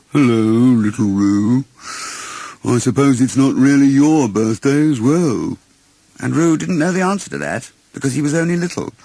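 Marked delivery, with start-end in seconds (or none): 0.1-5.6 slow, mournful donkey voice; gloomy Eeyore. Acted. Eeyore answers little Roo, slow and gloomy. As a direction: drag it out like a sorry old donkey. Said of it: "Eeyore replied gloomily".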